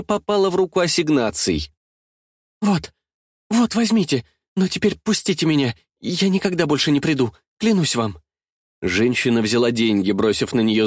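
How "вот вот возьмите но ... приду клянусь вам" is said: higher, anxious begging; hasty, timid promise; frightened pleading. A narrator's reading. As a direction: beg to be let go, falling away at the end. Frightened woman buys her way out.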